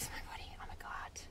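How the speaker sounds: hushed, voiceless amazement; awed whisper. Real, not acted. Reading a viewer's earth vision aloud, awestruck whisper. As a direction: drop suddenly into a stunned whisper.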